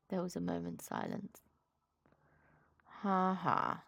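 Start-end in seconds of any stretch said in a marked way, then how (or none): 3.0-3.8 dry, sour mock laugh; bitter laugh. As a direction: spit out a joyless ha ha. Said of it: "said Eeyore bitterly".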